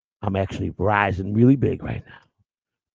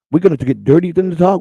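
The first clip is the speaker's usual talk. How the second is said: ordinary delivery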